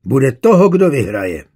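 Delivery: loud, firm, threatening terms; calmer but menacing. A narrator's reading. Rival sets terms to the suitor, menacing. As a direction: lay it down as a threat. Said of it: "Hovořil o poznání klidněji, ale výhružněji".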